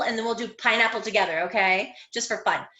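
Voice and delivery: usual speaking voice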